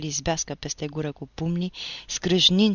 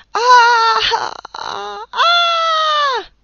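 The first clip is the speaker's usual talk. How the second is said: shrill, far higher screams of terror; terrified screams; 14 dB louder, much slower than usual. Acted. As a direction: scream in mad fear under the blows. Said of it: "cu un glas înnebunit de groază". (țipete de groază, foarte ascuțite)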